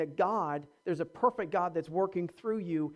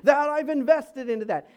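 lightly mock-boastful, fast and laughing; hammed-up bragging about hard practice; proud; 5 semitones higher, 4 dB louder than usual. Preacher mimics a proud musician answering praise, mock boast. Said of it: "a tendency in us to say".

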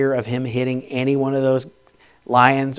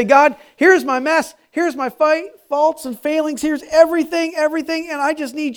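fervent surrender prayer; 12 semitones higher, 4 dB louder than usual. Retold or imitated. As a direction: pour your whole heart out to God. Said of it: "And say".

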